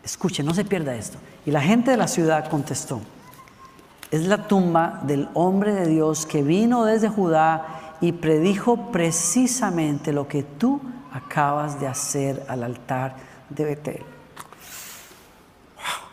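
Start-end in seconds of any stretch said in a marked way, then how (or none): 4.1-14.0 slow, low scripture reading; lectura bíblica. Acted. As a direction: read it out slowly and deliberately, low. Reads scripture aloud slowly. (lectura bíblica lenta y grave)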